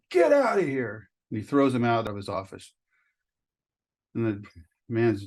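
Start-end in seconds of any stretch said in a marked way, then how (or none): 0.1-1.1 higher, slow shout of fed-up anger; fed-up dismissal. Acted. Talent agent orders the man out, in a fed-up shout. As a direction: throw him out with your voice. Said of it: "And the talent agent goes".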